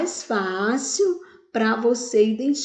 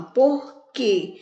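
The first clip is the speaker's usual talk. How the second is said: normal talking voice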